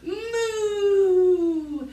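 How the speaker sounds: quick silly moo; cow moo. Pretend.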